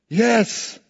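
high, loud triumphant yes; elated realization. Retold or imitated. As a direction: exclaim it as belief hits. John suddenly believes.

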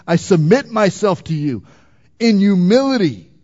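forceful preaching. Real, not acted. Preacher calls for immediate repentance, forceful preaching.

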